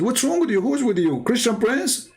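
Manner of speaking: high-pitched imitation of an incredulous wife; animated, high mock bewilderment; baffled wife. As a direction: challenge your overexcited husband in disbelief. Acted baffled wife on phone.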